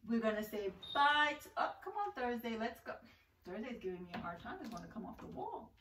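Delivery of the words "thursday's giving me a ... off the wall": muttered aside. Real, not acted. Struggling with card.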